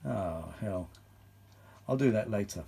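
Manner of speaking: ordinary delivery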